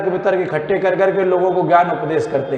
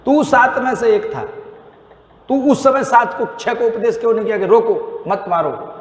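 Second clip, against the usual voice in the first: high, raised voice accusing Karna with rising anger; stern scolding; 7 semitones higher than usual. Acted. Krishna scolds Karna for the killing of Abhimanyu, high and stern. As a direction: hammer each accusation home, pitched up and hard. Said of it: "भगवान डांट भी लगा".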